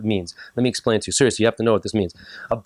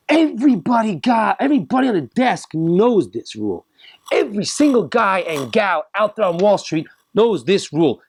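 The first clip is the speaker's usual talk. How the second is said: preacher-like fervor; rising emphatic declaration; 8 semitones higher than usual. Real, not acted. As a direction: drive the rule home. Emphatic buildup.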